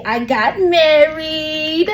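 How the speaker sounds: long drawn-out squeal on MARRIED; excited announcement. Real, not acted. Host telling listeners her big news, excited and drawn out.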